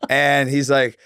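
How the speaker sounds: ordinary delivery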